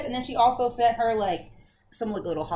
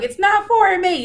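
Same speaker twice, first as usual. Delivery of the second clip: much higher and louder, hammed-up; imitating the friend refusing the gift; mock disbelief; 11 semitones higher, 11 dB louder than usual. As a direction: play the friend who can't believe it. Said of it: "She's like".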